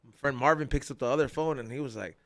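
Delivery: normal talking voice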